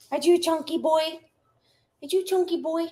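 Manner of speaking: high cooing pet voice. Real, not acted. Asking her dog in high baby talk. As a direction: coo at the chunky puppy.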